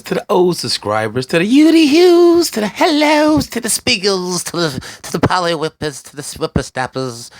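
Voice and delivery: playful falsetto roll call; squeaky shout-outs. Pretend. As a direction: greet the fans in a silly high squeak. Goofy shout-outs to viewers.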